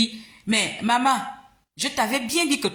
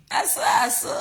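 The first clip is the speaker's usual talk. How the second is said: mock shout; 4 semitones higher than usual. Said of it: "comme je suis parfois tranchante".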